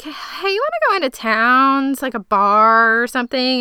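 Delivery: eager invite. Retold or imitated. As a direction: pitch the bar idea with a bright push. Retold Claire suggests going out.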